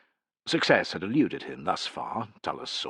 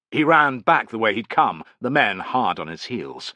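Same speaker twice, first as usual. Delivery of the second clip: usual speaking voice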